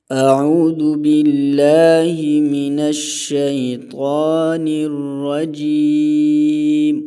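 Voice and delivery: slow, drawn-out melodic tajweed recitation; slow melodic Quranic recitation. Acted. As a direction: chant it slowly like a qari. Recites the ta'awwudh.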